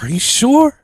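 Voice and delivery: menacing mock question from the virus; sly coronavirus. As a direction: let the virus tease them. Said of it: "coronavirus is like".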